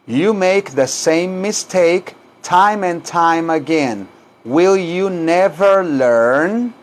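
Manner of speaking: very slow, stretched-out sentence for learners; slow repeat-after-me model. Shown as a demonstration. Teacher models the whole sentence for repetition, very slow. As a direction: draw out every word, rising on the question.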